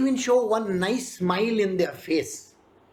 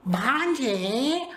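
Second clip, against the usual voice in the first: Shakuni's drawn-out call; 3 semitones higher than usual. Retold or imitated. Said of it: "they say".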